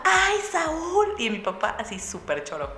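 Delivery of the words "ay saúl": high, loud fanboy squeal; dad gushing at his idol in delight; starstruck dad. Retold or imitated. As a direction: squeal his name like an overjoyed fan.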